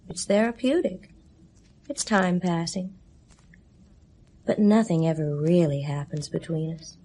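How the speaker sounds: ordinary delivery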